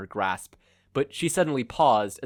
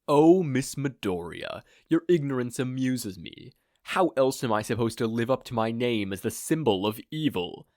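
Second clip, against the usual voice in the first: sinister amused villain; 3 dB louder than usual. Acted. Villain condescends to his captive, sinister and amused. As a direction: savor her ignorance. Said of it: "chuckled sinisterly".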